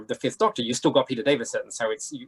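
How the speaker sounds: usual speaking voice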